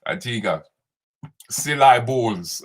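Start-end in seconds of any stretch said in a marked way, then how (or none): 1.5-2.6 "Silly balls" drawn out slowly as a joke; silly balls mispronunciation. Retold or imitated. Co-host mocking the mispronunciation slowly. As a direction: stretch out "Silly balls" teasingly. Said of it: "Oh my God".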